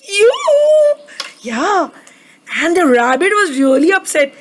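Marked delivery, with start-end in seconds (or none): 0.0-1.9 mock disgust. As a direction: squeal it in playful disgust. Disgust at the wiped nose.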